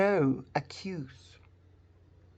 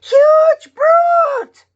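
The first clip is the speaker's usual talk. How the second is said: shouting "huge brute", much higher and louder; loudest voice; 11 dB louder than usual. Shown as a demonstration. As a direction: yell "huge brute" as loud as you can. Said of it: "Now make the loudest voice you can".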